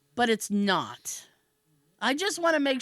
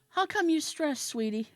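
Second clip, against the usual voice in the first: higher, cooing mock-therapist voice; nurse turned therapist; 5 semitones higher, 4 dB quieter than usual. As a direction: imitate a nurse playing therapist. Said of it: "all of a sudden she's my therapist".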